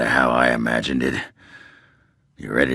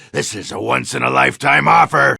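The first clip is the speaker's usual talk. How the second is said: loud sales pitch; 10 semitones higher, 4 dB louder, faster than usual. Acted. Dwarf pushing his brew.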